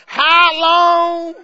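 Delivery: high loud drawn-out moan; playful mock lament; imitating a wailing saint. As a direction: call out like an old church member. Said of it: "some folks on one side of the room going".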